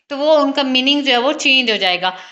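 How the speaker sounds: ordinary delivery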